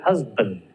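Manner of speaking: "Husband" with a weak schwa in the second syllable, "huz-bənd". Shown as a demonstration. Teacher models "Husband" for students, schwa on band. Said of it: "Did you hear that?".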